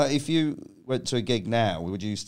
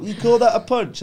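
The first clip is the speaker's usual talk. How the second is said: higher and louder scornful jeer; mocking taunt; 9 semitones higher, 6 dB louder than usual. Taunting the man after a punch, scornful. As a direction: sneer at his weak punch.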